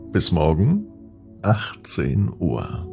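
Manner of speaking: ordinary delivery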